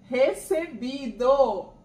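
ordinary delivery

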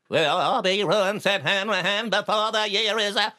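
high, droning robotic schoolboy recital; botched dialect reading. Retold or imitated. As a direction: chant it very high, mocking a boy's dialect attempt. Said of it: "had to read it in an Australian dialect".

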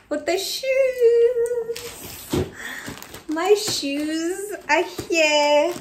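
high, loud, slow squeal of delight; excited squeal. Real, not acted. Vlogger to viewers, showing off new shoes, squealing. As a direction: stretch it out, thrilled. Unveiling the shoe haul.

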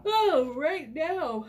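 talking through a yawn. Real, not acted. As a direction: yawn mid-sentence. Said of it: "And I'm yawning".

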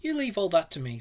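commanding and emphatic reassurance; masterful. A narrator's reading. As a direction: take command of the situation. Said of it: "said the masterful Rat".

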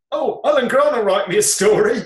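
high, loud mock boast; gleeful announcement. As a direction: act out breaking exciting news. Retold boast of good news.

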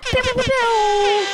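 drawn-out, squealed gun sounds; mock gunfire. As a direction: stretch out silly high-pitched shots. Imitating gunfire at Shootout City.

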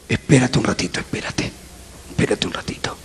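low, hushed voice of a pastor; susurro imitado. Retold or imitated. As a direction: quietly ask them to wait, almost whispering. Pastor retold telling him to wait. (en voz muy baja, casi susurrando)

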